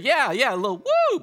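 high, bright call for a woo; hyping the crowd. Real, not acted. Preacher hyping the audience, excited high woo. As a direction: pump up the room. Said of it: "Let me hear it".

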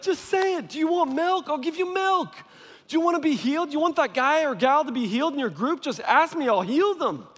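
very high, urgent comic pleading; exasperated, eager offer. Acted. God begging people to just ask, high-pitched and exasperated. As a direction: plead at the top of your range.

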